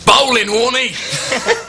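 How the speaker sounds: put-on stretched-out laughing Australian accent; slow, high Aussie cricket call. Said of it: "You do yours, [name]".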